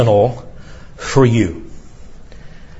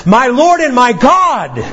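higher, slow, awestruck exclamation; overwhelmed cry; 7 semitones higher, slower than usual. Acted. Thomas overwhelmed by Jesus, awestruck high slow cry. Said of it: "Thomas is suddenly overwhelmed".

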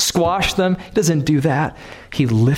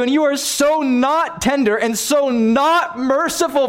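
much higher, driving impassioned sermon; preaching at full force; 9 semitones higher than usual. Acted. Preacher urging the church on God's patience, impassioned high preaching. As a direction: plead with the congregation at full fervor.

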